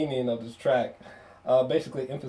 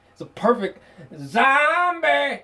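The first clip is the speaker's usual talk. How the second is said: high, slow goofy voice; a light goofy put-on voice; silly zombie slip; 10 semitones higher, 3 dB louder, slower than usual. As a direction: ham up the misheard word. Mock slip on combination.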